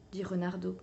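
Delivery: plain reading voice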